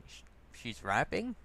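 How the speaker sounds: ordinary delivery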